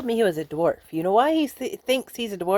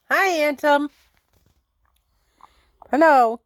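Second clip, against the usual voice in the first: high, loud hello to pets; pet greeting; 9 semitones higher, 5 dB louder than usual. Real, not acted. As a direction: call out high-pitched hellos to the animals.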